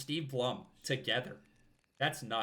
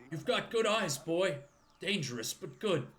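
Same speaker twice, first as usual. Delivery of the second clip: put-on old man voice; 6 semitones higher than usual.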